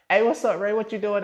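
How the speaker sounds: high, loud, put-on cheery hello; sister's breezy greeting. Sister greeting Ray while hiding news, breezy. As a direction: call it out high and casual.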